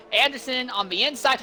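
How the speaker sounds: ordinary delivery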